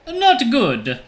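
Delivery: high, loud Italian cab driver; alarmed cartoonish Italian accent. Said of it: "stereotypical Italian voice".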